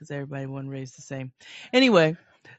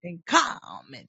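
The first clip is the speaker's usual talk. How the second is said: high, slow mimicked voice; hammed-up impression, stretched and high; Mama Joyce; 4 semitones higher, slower than usual. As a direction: draw it out like the woman being mocked. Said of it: "She be like".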